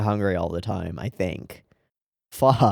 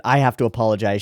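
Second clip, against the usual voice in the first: usual speaking voice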